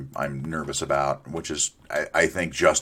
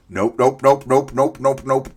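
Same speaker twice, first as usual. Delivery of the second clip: panicked nope; 8 semitones higher, 6 dB louder than usual. Real, not acted. Mob charging at player.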